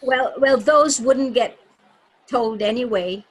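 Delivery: normal talking voice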